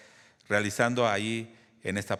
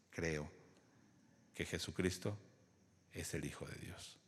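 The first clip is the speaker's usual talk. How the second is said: hushed reverent confession; 7 semitones lower, 14 dB quieter than usual. Retold or imitated. Eunuch's confession of faith.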